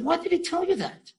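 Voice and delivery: high-pitched, taken-aback voice; retold startled question. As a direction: imitate a startled woman, voice jumping up high.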